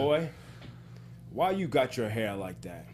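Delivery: booming big-man voice; Mark Henry impression. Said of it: "so give me a second".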